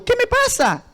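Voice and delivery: high-pitched exasperated wail; retold cry of a man at the end of his rope; desperate outburst. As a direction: plead upward in disbelief. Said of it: "Y comencé a llorar".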